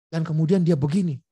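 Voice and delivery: ordinary talk, nothing marked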